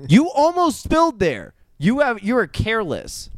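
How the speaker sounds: lively play-by-play call; sports commentator. Pretend. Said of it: "You sound like a sports commentator".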